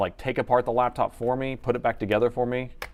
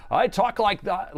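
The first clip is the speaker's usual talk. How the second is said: higher, slow radio host imitation; radio announcer; 9 semitones higher, slower than usual. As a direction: mimic a DJ on the microphone. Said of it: "he talks like that when he's on the microphone".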